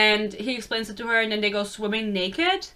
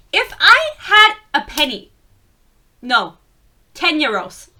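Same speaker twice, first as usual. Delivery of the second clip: loud, high dramatic declaration; a big, high-pitched theatrical complaint; mock exasperated outburst; 5 semitones higher, 8 dB louder than usual. Mock exasperation at a book trope.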